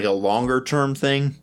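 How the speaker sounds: usual speaking voice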